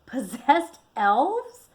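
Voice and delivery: loud, slow, shocked blurt. A narrator's reading. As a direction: blurt it out in disbelief. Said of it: "he blurted to himself".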